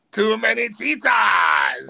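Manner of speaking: slow, laughing punchline, voice breaking up. Real, not acted. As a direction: deliver the punchline and crack up at it. Punchline of dad joke.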